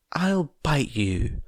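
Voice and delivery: put-on a small child trying to sound menacing; mock menacing child. A narrator's reading. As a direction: Eri threatening the investigators, slow. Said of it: "said Eri malevolently".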